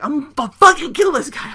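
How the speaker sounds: high, loud mock outburst; hammed-up delusional threat; almost delusional. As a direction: rant it like someone losing it. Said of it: "you know, almost delusional".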